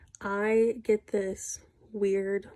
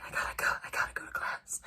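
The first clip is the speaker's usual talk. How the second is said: imitating a whispered, apologetic getaway; whispered excuse; 6 dB quieter than usual. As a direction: whisper it while slipping past. Roommate's hurried excuse retold.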